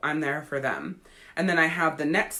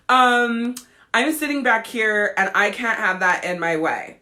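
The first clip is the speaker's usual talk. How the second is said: higher-pitched bitchy objection, louder drawn "um"; 5 semitones higher, 4 dB louder than usual. Retold or imitated. Football mom objecting to the canopy, bitchy. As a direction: put on a snippy complainer. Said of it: "she was all bitchy".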